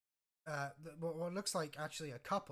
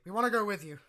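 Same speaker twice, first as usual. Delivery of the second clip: raised, louder declaration; higher, forceful plea to come along; villagers declaring together; 7 semitones higher, 13 dB louder, faster than usual. Acted. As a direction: blurt it out boldly as one. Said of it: "then in unison they say".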